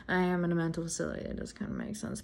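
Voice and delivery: fast, hushed aside trailing to a whisper; inner thought. Retold or imitated. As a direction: mutter it to yourself, then whisper.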